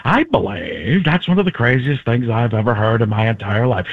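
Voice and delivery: airy voice with added gravitas; Paul Giamatti. Shown as a demonstration. Comedian morphing one impression into another, grave voice. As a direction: declare it weightily. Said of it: "add a little more gravitas, it becomes Paul Giamatti".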